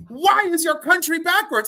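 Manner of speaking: high, drawn-out mock bafflement; slow it down and pitch it up, quoting yourself; imitating your own incredulous question; retold baffled question. Retold teasing question to Canadian.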